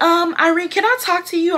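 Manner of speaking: high-pitched mimicry of the manager; put-on manager voice, high; imitating manager. Retold manager calling her aside.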